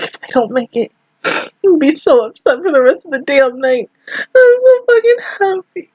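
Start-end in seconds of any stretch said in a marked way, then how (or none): 4.1-5.9 high, tearful wail on so; gushing through real tears; happy crying. Real, not acted. Fan weeping with happiness for her girls. Fan overjoyed by characters' success.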